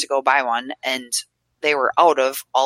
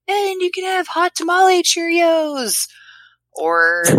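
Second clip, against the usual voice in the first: high, slow, louder hyped commercial voice; mock ad announcer; 5 dB louder, slower than usual. Mocking Cheerios' endless flavors as an ad announcer. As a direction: sell the silly flavor like a TV ad.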